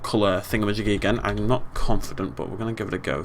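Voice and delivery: ordinary delivery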